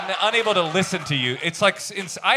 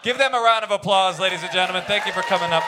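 rally the crowd for a round of applause; announcer sign-off; 4 semitones higher than usual. Acted. Host thanking the guests on stage, announcer voice.

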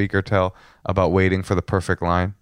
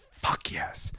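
voiceless, hushed triumph; retold whisper; 12 dB quieter than usual. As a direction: whisper it like a private victory. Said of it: "And he's like".

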